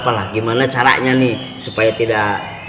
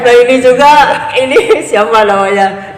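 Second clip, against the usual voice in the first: excited; 11 semitones higher, 7 dB louder than usual. Real, not acted. Excited joking introduction of guest.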